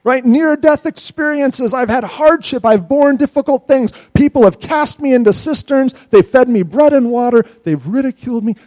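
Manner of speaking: loud, high lament; crying out. Acted. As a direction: pour out the complaint. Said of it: "He says".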